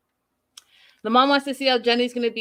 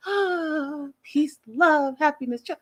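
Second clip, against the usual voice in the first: higher, dreamy chant of peace words; mock serene devotee; 6 semitones higher, slower than usual. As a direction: play the blissed-out devotee, mocking. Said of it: "She was not like".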